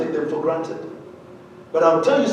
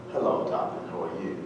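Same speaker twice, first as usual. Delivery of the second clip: British; 11 semitones lower, 13 dB quieter than usual. Retold or imitated. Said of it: "the Brits are very much what?".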